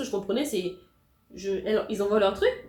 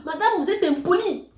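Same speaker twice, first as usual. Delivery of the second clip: higher, louder mock irritated advisor; annoyed advisor; 9 semitones higher, 6 dB louder than usual. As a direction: snap back as the offended advisor. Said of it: "c'est là où elle s'énerve". (conseillère vexée imitée, plus aiguë)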